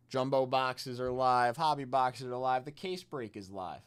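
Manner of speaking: loud hype announcer call; rapid hype card breaking. Acted. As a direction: pump up the chat, announcer style.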